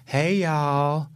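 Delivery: lightly imitated lisping Southern guy; a hint of effeminate Southern accent. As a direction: drawl a mock Southern greeting, effeminately. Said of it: "effeminate with a lisp Southern guy".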